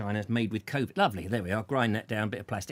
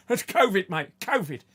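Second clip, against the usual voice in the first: mock delivery man; 5 semitones higher, 3 dB louder than usual. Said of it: "I'm going".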